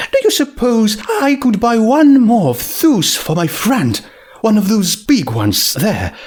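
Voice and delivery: a gracious lady making a kind request; Rarity voice. A narrator's reading. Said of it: "Rarity asks Junebug politely".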